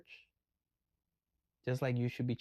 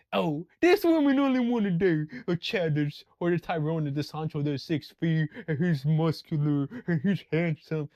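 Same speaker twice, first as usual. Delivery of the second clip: a touch of whining man, louder; mock whiny complaint; 2 semitones higher, 6 dB louder than usual. Mocking men complaining about women, loud whine. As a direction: parody the online commenters.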